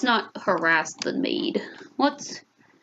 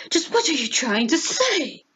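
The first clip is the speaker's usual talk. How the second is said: hammed-up indignation, pitched up; mock offended; 4 semitones higher than usual. Heir answering the new maid, mock offence. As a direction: play at being affronted, rising in pitch.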